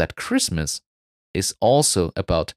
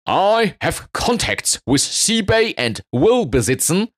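calling out from crowd; 10 semitones higher than usual. Acted. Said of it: "suddenly calls out from the crowd".

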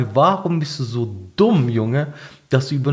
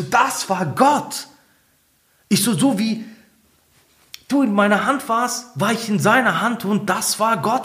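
raised ecstatic voice; 7 semitones higher than usual. Retold or imitated. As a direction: proclaim it, carried away. Telling her God saved them. (höher, verzückt verkündet)

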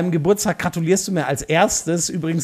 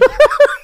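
sharp laugh; 9 dB louder than usual. Real, not acted. Laughs at donut joke.

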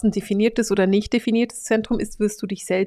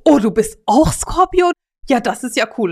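delighted Scorpio; 3 semitones higher, 5 dB louder than usual. Retold or imitated. One Scorpio meeting another, gushing with joy. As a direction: play two Scorpios thrilled to meet. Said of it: "wie viel Freude die haben".

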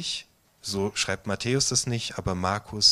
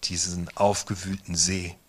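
guided meditation; 3 dB quieter than usual. Acted. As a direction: guide them gently into the storm scene. Leitet eine Meditation. (ruhige Meditationsstimme, langsam mit langen Pausen)

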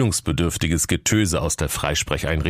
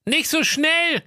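king's voice; slower than usual. A narrator's reading. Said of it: "rief da der König der Schrankenwärter".

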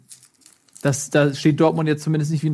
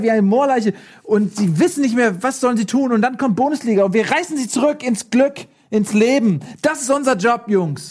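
raised, rousing hype speech; energetic; 6 semitones higher than usual. Pretend. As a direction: pump the crowd up like a showman. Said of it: "ein bisschen energetisch sein".

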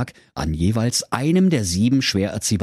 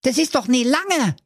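high, whiny dwarf voice; a small dwarf protesting; sulky dwarf. Acted. Justin defends himself. (hohe, quengelnde Zwergenstimme)

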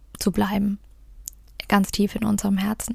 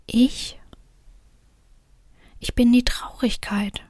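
faltering, barely audible whisper; halting whisper; 3 semitones higher than usual. A narrator's reading. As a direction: whisper it haltingly, almost inaudible. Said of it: "flüsterte die Stimme stockend und so leise".

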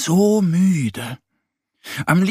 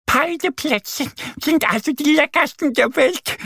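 much higher cheerful duck voice, laughing at the end; happy duck. Acted. Annie laughing to Mo after the blind tasting, high happy duck. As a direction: delight as the mix-up resolves. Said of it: "sagt Annie und lacht".

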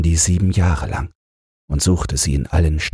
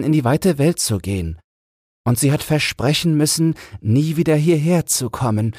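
put-on higher voice of the old mother; the old mother calms the king, in a higher voice; comforting old mother; 8 semitones higher than usual. A narrator's reading. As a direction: console the weeping son.

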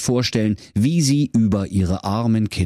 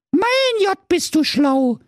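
put-on high amazed child; squeaky impressed little goat; little kid voice; 3 dB louder, slower than usual. A narrator's reading. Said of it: "sagte das kleine Geißlein".

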